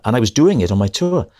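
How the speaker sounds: usual speaking voice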